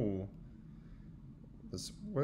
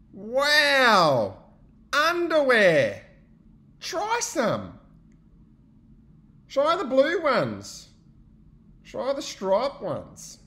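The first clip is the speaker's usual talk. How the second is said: excited friends; 12 semitones higher, 10 dB louder, much slower than usual. Acted. Friends react to underwear.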